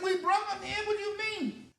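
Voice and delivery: very high-pitched mock outrage; indignant protest. Teen arguing with police over cigarettes, indignant. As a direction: squeal back in disbelief.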